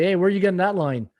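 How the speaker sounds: normal talking voice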